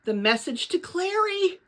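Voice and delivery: suddenly remembering, horrified; dismayed exclamation. Acted. Lena realizes she forgot note.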